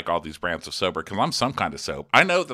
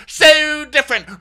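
squealed boast; 13 dB louder, slower than usual. Retold or imitated. Peak of James boast.

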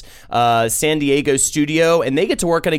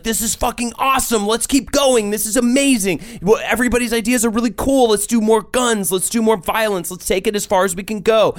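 higher, hyped company cheerleader; excited cheerleader; 6 semitones higher than usual. Retold or imitated. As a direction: pump everyone up like a cheerleader. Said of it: "About just being like".

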